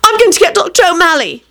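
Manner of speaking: high, urgent cry; panicked announcement. A narrator's reading. Rosie running for the doctor, high and panicked. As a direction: call it out high while rushing off.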